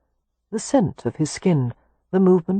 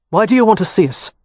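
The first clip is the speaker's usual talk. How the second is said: fast, louder, curt question; brusque; 4 semitones higher, 6 dB louder, faster than usual. A narrator's reading. Kev confronting his unknown grandfather, brusque. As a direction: fire it off brusquely, higher and quick. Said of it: "Kev asked brusquely".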